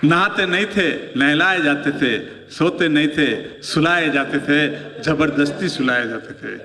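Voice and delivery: slow recitation sinking low; poem recital. Acted. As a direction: recite the refrain slowly, ending low and heavy. Refrain recited again. (धीमा कविता पाठ)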